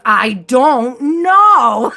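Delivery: slow, loud, fed-up "I don't know" with an eye roll; imitating frustrated toddler. Imitating toddler, asked again by mom's friend, frustrated and loud. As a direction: drag it out like an exasperated little kid asked twice. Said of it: "very frustrated and seriously".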